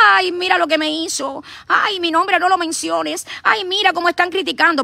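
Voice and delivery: high, whining mock of critics crying foul; mocking whiny complaint. Mocking her critics, a high whiny complaint. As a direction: mimic detractors whining like crybabies. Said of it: "salen chillando como".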